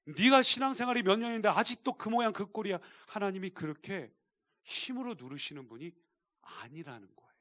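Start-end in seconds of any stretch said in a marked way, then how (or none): 0.0-2.8 loud, high-pitched acted telling-off; browbeating scolding. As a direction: play an overbearing scolder laying into someone. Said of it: "윽박지르고 막 강요하고". (윽박지르듯 크게 다그치는 연기)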